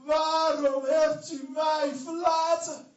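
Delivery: high, shouted, slow lament; anguished cry. Acted. Jesus on the cross cries out to his Father, shouting. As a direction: cry out to God in agony. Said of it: "riep hij".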